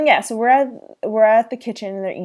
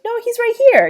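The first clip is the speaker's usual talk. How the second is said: put-on much higher and louder little-girl protest; Rudy's protest; 8 dB louder, much faster than usual. Recapping Rudy refusing to believe her fish died, high loud protest. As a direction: squeal it like a kid denying the news. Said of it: "Rudy's like".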